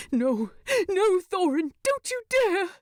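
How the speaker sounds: high, breaking, near tears; begging a dying friend not to go; desperate crying plea. Acted. Bilbo sees Thorin dying.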